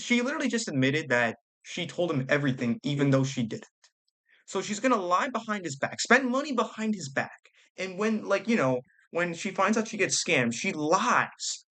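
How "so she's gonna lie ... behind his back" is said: indignant. Real, not acted. Outraged at wife's lying.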